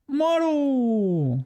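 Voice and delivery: high, bright call out to a friend; excited call. Real, not acted. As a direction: call the name out happily. Calling out to a chatter.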